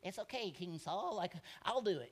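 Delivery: soft, gentle reassurance to the king; reassuring pipsqueak. Retold or imitated. David reassuring the frightened King Saul, softly. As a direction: comfort him kindly, small voice. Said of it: "little old Pipsqueak".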